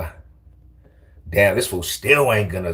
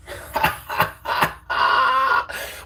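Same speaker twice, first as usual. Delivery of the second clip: high airy wheeze of laughter; high whispery giggle; high breathless laugh. Real, not acted. Laughing at chat.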